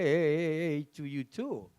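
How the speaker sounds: usual speaking voice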